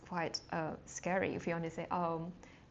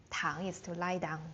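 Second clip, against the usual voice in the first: ordinary talk, nothing marked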